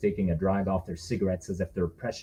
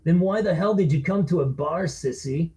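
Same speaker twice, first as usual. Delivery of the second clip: put-on sneering drunk; 7 semitones higher than usual. Bully taunts.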